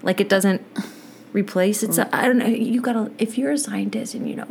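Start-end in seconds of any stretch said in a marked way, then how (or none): 3.2-4.5 whispered aside; whispering. Real, not acted. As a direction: drop to a whisper. Aside to listeners.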